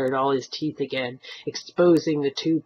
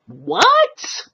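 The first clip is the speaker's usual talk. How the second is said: high, loud outraged what; shouted; 13 semitones higher, 6 dB louder than usual. Acted. Old man outraged at the stranger's threat, shouting. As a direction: bark it in outrage. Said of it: "old Mister Gumbiner shouted".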